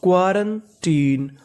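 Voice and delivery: slow, drawn-out quarantine syllable by syllable; slow pronunciation model; slow demo word. Shown as a demonstration. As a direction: stretch out quarantine as kwa-ran-teen. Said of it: "तो पूरी meaning मिला के पढ़ते हैं".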